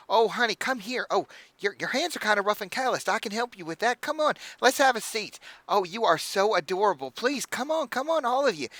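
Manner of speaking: high, gushing hostess voice; bubbly halfling innkeeper. Acted. Innkeeper welcoming the party, high and gushing. Innkeeper pulling Elizabeth inside.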